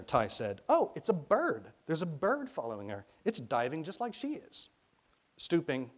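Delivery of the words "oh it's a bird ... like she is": put-on high, eager child's voice; excited discovery. A narrator's reading. Ty seeing a bird dive after her, excited. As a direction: pipe up high, thrilled by what you spot.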